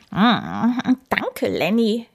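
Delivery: smacking lips. A narrator's reading. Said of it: "schmatzt sie".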